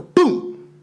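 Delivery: high, punchy door-bang noise; mock crash sound; boom sound effect. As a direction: slam it like a door bursting open. Dad bursts through door.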